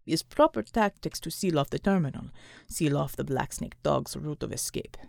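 low, steady male voice; deep soldier voice. A narrator's reading. Untermensch's voice.

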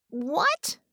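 high, startled what; shocked cry. Acted. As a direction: blurt it in disbelief. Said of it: "Bella cried".

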